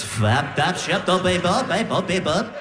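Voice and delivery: bouncing reversed-speech gibberish; backwards record. Acted. Imitating girlfriend's sleep talk, backwards-record babble. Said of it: "Sounds like you're turning a record backwards".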